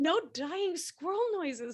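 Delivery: high, slow laughing plea. Real, not acted. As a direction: beg through laughter. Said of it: "joking plea to friend".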